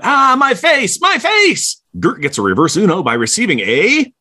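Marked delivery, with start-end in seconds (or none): 0.0-1.8 high-pitched comic cry of agony; mock scream. Thunderball succumbing to dinosaur's embrace, high mock scream. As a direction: wail it high, hammed-up, without full volume. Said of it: "screams out".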